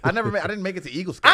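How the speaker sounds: excited. Acted. Excited boast, fast and loud.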